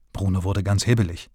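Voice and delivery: usual speaking voice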